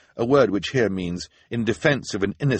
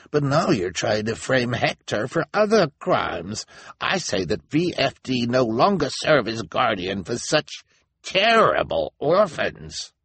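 higher elder's voice, building to drawn-out disgust; accusing elder; 6 semitones higher than usual. A narrator's reading. Elder disowns the orphans before the town, indignant. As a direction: denounce them, leaning on the last words.

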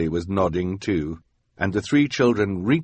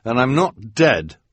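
put-on raised, firm boy voice; lightly voiced boy; firm Quigley; 4 semitones higher, 5 dB louder than usual. A narrator's reading. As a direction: deny it flatly. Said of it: "Quigley said".

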